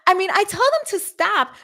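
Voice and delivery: lightly mimicked defensive teacher. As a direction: brush it off to cover herself. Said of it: "and she goes".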